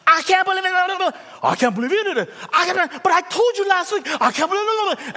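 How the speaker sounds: high, loud mock disbelief; shocked spouse. Preacher mimics a stunned spouse, high and loud. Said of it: "just apologize".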